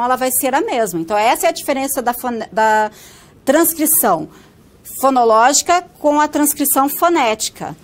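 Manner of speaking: ordinary talk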